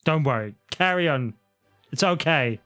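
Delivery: ordinary talk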